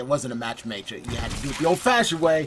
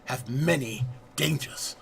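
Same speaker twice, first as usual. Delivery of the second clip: playful, hammed-up French accent narrating the pirate adventure; a self-confessed terrible French accent, overplayed for laughs; mock French accent. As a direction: put on a deliberately bad French accent, like a cheesy pirate guide. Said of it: "I have a terrible French accent".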